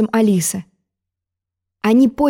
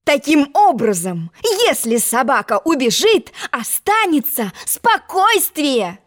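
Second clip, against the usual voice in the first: triumphant; 5 semitones higher, slower than usual. Acted. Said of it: "Торжествовала".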